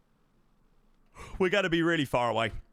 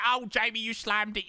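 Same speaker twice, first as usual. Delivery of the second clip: mock chat praise; 9 semitones higher than usual. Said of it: "That all of you were like".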